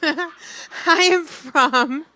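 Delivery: slowed words broken by laughter; can't get it out for laughing; laughing through words. Real, not acted. Retelling breaks into laughter.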